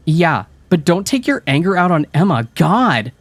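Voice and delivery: high, loud exasperated jab; eye-rolling. A narrator's reading. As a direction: roll your eyes as you say it. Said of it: "rolling her eyes".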